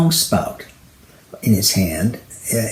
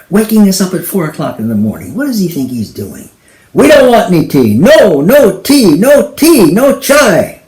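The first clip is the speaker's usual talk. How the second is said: loud, higher angry rant; pissed off; 8 semitones higher, 7 dB louder than usual. Acted. Husband yelling at the tea seller, angry. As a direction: rant at the door, fed up. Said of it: "He was pissed".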